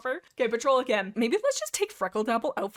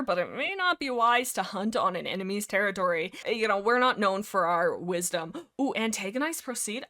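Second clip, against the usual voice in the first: ordinary talk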